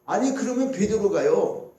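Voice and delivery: ordinary talk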